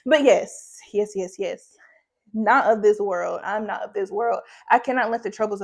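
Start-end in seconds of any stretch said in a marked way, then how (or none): none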